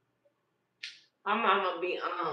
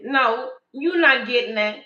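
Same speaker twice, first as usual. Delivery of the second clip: much louder flat no; mother loudly refusing; loud refusal; 5 semitones higher, 13 dB louder than usual. Retold or imitated. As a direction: shut the toddler down. Daughter refusing the toddler.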